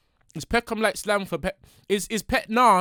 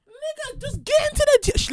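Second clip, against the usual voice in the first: much higher mock-exasperated cry; impatient outburst. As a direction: cut the intro off, exasperated. Interrupts the intro again.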